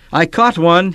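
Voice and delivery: high, loud yell of triumph; excited yell. A narrator's reading. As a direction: shout it out, proud of the catch. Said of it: "he yelled".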